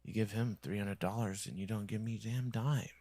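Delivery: normal talking voice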